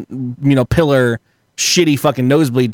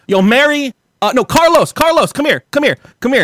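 calling people over; 10 semitones higher than usual. Retold or imitated. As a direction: yell names to summon coworkers. Calling staff over at window.

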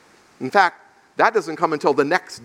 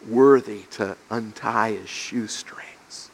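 slow, low, near-whispered awe; soft, reverent unworthiness; hushed humility; 7 semitones lower, 5 dB quieter, slower than usual. Acted. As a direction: drop to a breathy hush, humbled. John's humility before Jesus, voiced.

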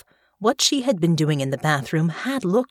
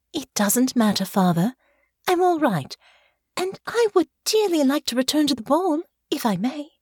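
put-on light, higher voice of a polite young lady; sweet young noblewoman; 9 semitones higher than usual. Daughter asking her father to return to the ball, sweetly pleading. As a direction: coax your father gently, all sweetness.

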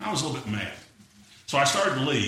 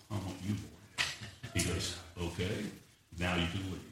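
low, very soft retold exchange with God; hushed prayer; 9 semitones lower, 11 dB quieter, slower than usual. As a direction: drop right down, intimate and reverent. Retold tearful prayer and God's answer.

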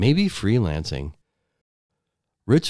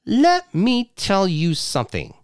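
angry wife; 11 semitones higher than usual. Acted. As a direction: throw him out at the top of her voice. Wife squares up.

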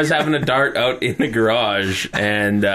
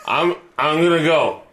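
mock drunk yell; 5 semitones higher than usual. Friend announcing his exit at the front door, mock yell. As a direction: imitate a drunk man calling out, half-hearted volume. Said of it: "I can hear him yell".

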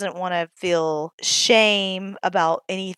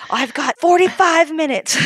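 high, loud burst of mock elation; excited mock quote; 8 semitones higher, 7 dB louder than usual. Mom crowing over a short break while the babies nap, excited. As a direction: crow it brightly, pitched way up. Mimics mom celebrating brief free time.

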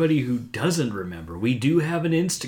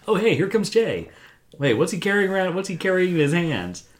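raised, louder play-acted spotting; mock onlooker commentary; 7 semitones higher, 5 dB louder than usual. Joking as investigators spotting Jay approaching, mock excited commentary. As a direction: call it out like a surprised onlooker.

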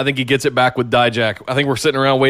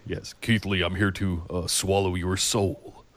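deep, quiet menacing wrestling promo; much lower and softer, soul-swallowing menace; Damian Priest promo; 7 semitones lower, 10 dB quieter than usual. Retold or imitated. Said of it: "hear that voice".

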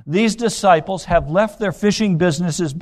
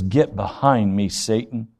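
low, blunt rebuke, dropping off on Satan; 7 semitones lower than usual. Retold or imitated. Jesus bluntly rebuking Peter, low and blunt. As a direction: bluntly dismiss him in a low voice. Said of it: "speaks to him very bluntly".

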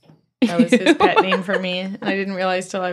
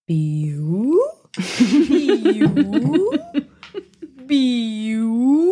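fart beep imitation; 5 semitones higher, much slower than usual. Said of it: "Dave's butt went".

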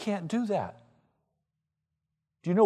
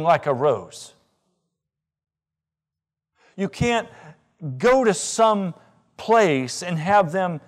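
higher, louder, insistent sermon; higher, louder preacher's fervour; full-force preaching; 7 semitones higher, 8 dB louder than usual. Acted. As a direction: pound each phrase home.

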